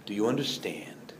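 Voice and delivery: whispered repeat of the question. Acted. Jesus presses the disciples again, now whispering. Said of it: "repeated as a whisper".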